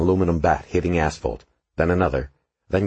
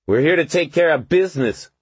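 high, louder tough-guy voice; cocky young thug; 11 semitones higher, 4 dB louder than usual. A narrator's reading. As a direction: swagger through it. Gang member answers cop.

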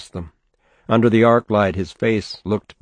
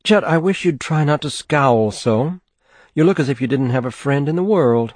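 higher, mild chiding mother; a mother gently scolding; 6 semitones higher than usual. A narrator's reading. Mother chiding her son.

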